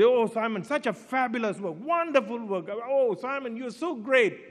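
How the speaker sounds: high, mock-effusive "Oh, Simon"; gushing Western praise. Westerners praising the pastor for shipping furniture, mock gushing. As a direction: lay the praise on thick, half mocking. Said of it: "they will just praise you like anything".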